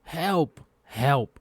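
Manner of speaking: play-distressed cries for rescue; mock call for help. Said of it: "I'm calling for dev help".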